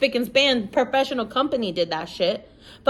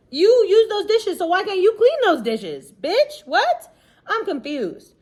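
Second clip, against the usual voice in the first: sassy rant; 9 semitones higher than usual. Real, not acted. Sassy rant at lazy partner.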